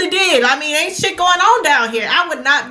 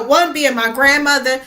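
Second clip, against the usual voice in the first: ordinary talk, nothing marked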